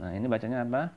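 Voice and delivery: usual speaking voice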